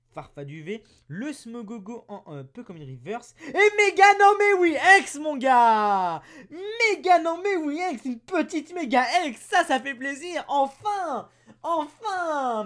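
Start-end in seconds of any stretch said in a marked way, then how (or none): 3.5-12.6 loud, high, overjoyed yelling; excited shouting. Real, not acted. Pulling the card he wanted, loud excited yelling. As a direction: shout with joy at the pull. Pulls a rare mega card.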